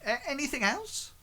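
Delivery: high-pitched questioning prompt; lightly acted high query; in-character prompt. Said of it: "he sort of says".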